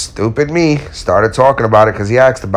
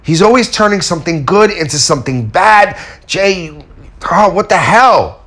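higher, exasperated self-quote; fed-up rant; 8 semitones higher, 3 dB louder than usual. Retold or imitated. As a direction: vent about the friend, louder. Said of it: "I was like".

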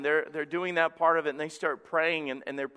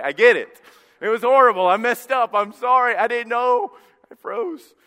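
mock apology; 8 semitones higher, 10 dB louder than usual. Mock apology to wife about kiss.

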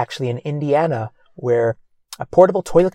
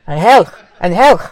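raised, louder acted call; 5 semitones higher, 8 dB louder than usual. Friend trying to get Angel's attention, calling out.

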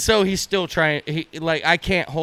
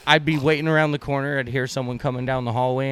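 ordinary talk, nothing marked

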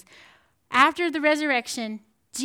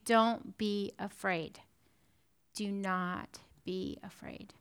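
tender reassurance; 5 semitones lower, 9 dB quieter, slower than usual. Acted. Jesus comforting, soft and slow.

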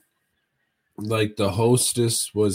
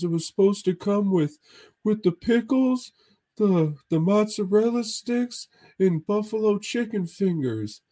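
much higher, goofy imitated friend's voice; Mahoney impression; 10 semitones higher than usual. Friend ordering appetizers from the waitress, high goofy imitation. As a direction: mimic a buddy's high, hesitant ordering voice. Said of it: "It's like".

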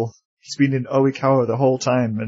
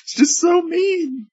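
whiny pout; slower than usual. Acted. Mock pouty complaint.

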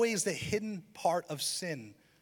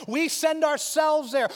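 slow, higher and louder, each word weighed; emphatic; 10 semitones higher, 8 dB louder, slower than usual. Shown as a demonstration. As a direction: preach the line out. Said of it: "He doesn't send us".

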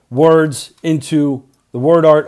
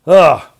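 loud groan; 2 semitones higher, 8 dB louder than usual. Real, not acted. Complaining about Saturday podcast.

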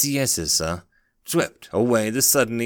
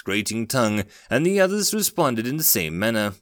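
usual speaking voice

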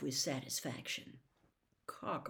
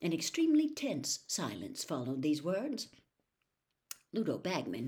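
ordinary delivery, nothing marked

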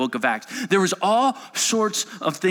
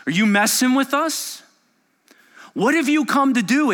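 higher, louder frightened demon; 10 semitones higher, 5 dB louder than usual. Acted. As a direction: shriek the challenge at Jesus. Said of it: "this demon says to Jesus".

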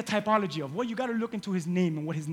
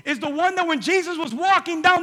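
loud, high preaching; 8 semitones higher, 8 dB louder than usual. Acted. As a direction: preach with fire. Preaching at full force.

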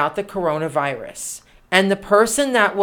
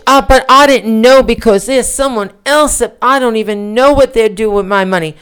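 mock Georgia accent; 2 semitones higher, 6 dB louder than usual. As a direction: mimic her excuse in an exaggerated Georgia accent. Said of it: "I'll do my best Georgia accent".

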